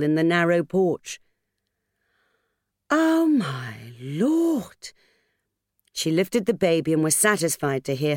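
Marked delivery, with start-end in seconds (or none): 2.9-4.9 slow, drawn-out "Oh, my Lord"; astonished at the bundle; shocked gasp. Acted. Finds abandoned baby on doorstep.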